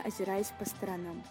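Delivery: ordinary delivery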